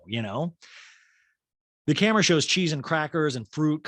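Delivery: ordinary delivery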